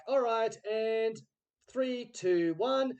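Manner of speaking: loud, high director countdown; calling the countdown. Retold or imitated.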